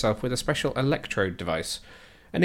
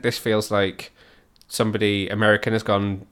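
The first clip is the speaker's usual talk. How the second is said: ordinary talk, nothing marked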